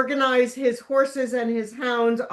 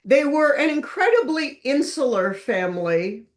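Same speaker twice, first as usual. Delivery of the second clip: ordinary talk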